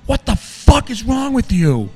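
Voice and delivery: high, drawn-out enraged yell; screaming in anger. Retold or imitated. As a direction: re-enact screaming at another driver. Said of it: "and I scream".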